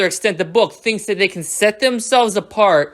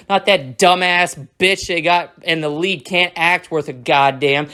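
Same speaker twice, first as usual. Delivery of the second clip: ordinary delivery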